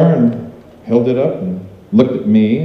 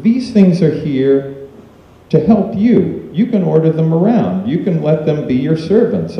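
shaman's counsel in a raised pitch; quoted shaman; 6 semitones higher than usual. Retold or imitated. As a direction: lay it out like a teacher to a pupil. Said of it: "And he said".

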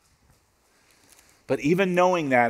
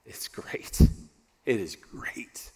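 a near-whisper of wonder at the calm; hushed awe; 3 semitones lower, 4 dB quieter, slower than usual. Shown as a demonstration. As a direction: whisper "great" softly and slowly. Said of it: "listen to how he describes the calm".

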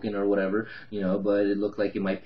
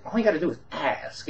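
higher, snide little jab; smart-ass; 7 semitones higher than usual. Retold or imitated. Tenant lecturing the investor, snide higher mock voice. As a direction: needle him with it. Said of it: "really smart ass with me".

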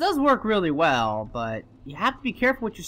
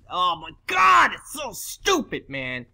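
exasperated; 4 semitones higher, slower than usual. Real, not acted. Fed up with the game.

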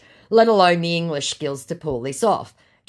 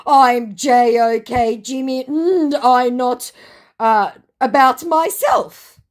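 slow, loud, high mock reading; mocked scammer; 7 semitones higher, 6 dB louder, slower than usual. Scammer claims to be Jimmy, mocking slow voice. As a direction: read it as a laboured, stilted imitation.

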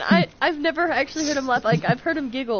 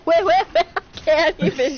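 imitating laughing friend; 4 semitones higher, 4 dB louder than usual. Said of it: "He said".

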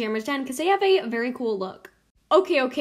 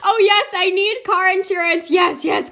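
eager acting; 6 semitones higher, 6 dB louder than usual. Acting out keen customer's reply.

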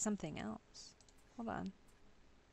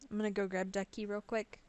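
ordinary talk

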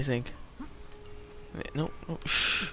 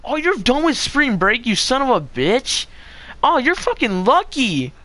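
loud, higher-pitched exclamation; envious outburst; 10 semitones higher, 7 dB louder than usual. Real, not acted. Streamer hearing a friend finished spring break, loud envious outburst. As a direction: burst out loudly in envy, swearing.

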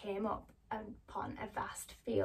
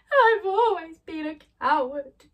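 higher, louder sobbing lion; 7 semitones higher, 11 dB louder than usual. Acted. Lion confesses to Dorothy while sobbing. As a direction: wail it through sobs. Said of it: "he said through his sobs".

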